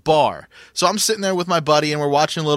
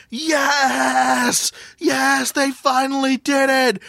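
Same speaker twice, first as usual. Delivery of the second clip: high, shouted cheer of joy; retold scream; 10 semitones higher, much slower than usual. Fan at the bar screaming at the goal, high excited shout. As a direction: yell it like the winning goal just went in. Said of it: "I just scream".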